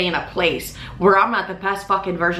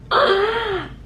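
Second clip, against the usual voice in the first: a sharp gasp pitched far higher; high shocked gasp; 14 semitones higher than usual. Retold or imitated. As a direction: gasp high and sudden at the news. Shock at the rental news.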